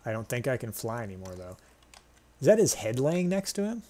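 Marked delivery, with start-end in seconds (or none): 2.4-3.8 high startled disbelief; surprised. Real, not acted. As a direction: react like you just noticed something shocking. Shocked at griffin's severed head.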